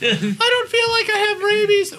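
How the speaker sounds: put-on high, slow whiny kid; child voice. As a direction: plead like a scared little kid. Playing the child pleading.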